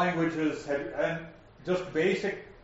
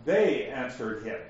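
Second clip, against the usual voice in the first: They pitched up and hit harder, rest falling away; stress on They; 4 semitones lower than usual. Shown as a demonstration. Teacher reading the verse to show opening emphasis, stress on They. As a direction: lean hard on the opening word They. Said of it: "without emphasizing the word they".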